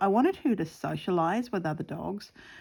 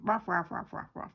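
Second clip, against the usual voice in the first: dog bark imitation; 4 dB quieter than usual. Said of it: "so she barks at them".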